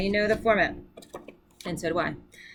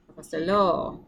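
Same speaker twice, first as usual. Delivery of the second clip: "Apostello" with a long, stressed last "o"; circumflex on final O; 3 semitones lower than usual. Shown as a demonstration. Teacher pointing out the circumflex to students, long final "o" in "Apostello". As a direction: lean on the final LO of "Apostello", falling. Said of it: "you hear that, oh, the circumflex".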